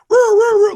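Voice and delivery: high, loud mock siren wail; imitated police siren. [name] imitating the police siren he heard, mock siren wail. Like the good old days.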